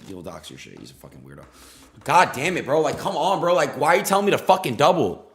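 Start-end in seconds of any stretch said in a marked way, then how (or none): 2.0-5.3 loud, higher exasperated rant; frustrated yell. Real, not acted. Streamer venting at the chat that told him to double, loud. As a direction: vent the anger in a burst.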